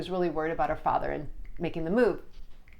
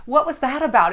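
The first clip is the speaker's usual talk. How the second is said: higher and louder, eager; excited; 6 semitones higher, 6 dB louder than usual. Real, not acted. Medium asks client about the popcorn, excitedly. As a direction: push the question out with wonder.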